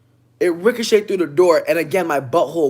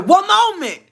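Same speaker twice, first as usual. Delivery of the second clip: very high, loud, prim chirp; high polite voice; 14 semitones higher, 6 dB louder than usual. Retold or imitated. To the coworker, a very high strained polite reply. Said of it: "I said".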